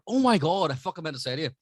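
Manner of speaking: high, loud burst of excitement; sudden real excitement; excited remembering. Real, not acted. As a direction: blurt it as big news hits you. Suddenly remembers exciting news to tell.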